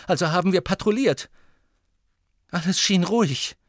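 agitated, high-pitched account; upset professor insisting all was secured; Professor McGonagall. Acted. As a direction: report urgently, voice climbing. McGonagall recounts the night.

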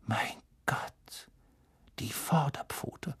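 very soft, breathy realisation; hushed, halting amazement; stunned whisper. A narrator's reading. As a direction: whisper it in dawning shock. Lupin's hushed shock at the photo.

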